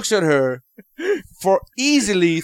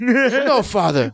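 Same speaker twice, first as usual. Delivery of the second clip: put-on young British jaguar, high on the no; British accent; 7 semitones higher, much slower than usual. Young jaguar answering father, mock British accent. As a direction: protest in a hammed-up British accent. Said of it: "the Jaguar's British in this one".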